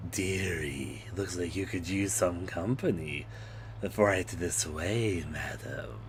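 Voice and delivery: low, soft creepy innkeeper voice; creepy hotel owner. Retold or imitated. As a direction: ooze it like a sinister host. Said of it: "talking like you're some creepy hotel owner".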